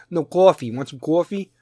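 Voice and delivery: insistent Jersey "cawfee"; hammed-up Jersey rounded vowel on "coffee"; Jersey accent. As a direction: correct them in a put-on East Coast Jersey accent. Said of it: "Jersey East Coast accent".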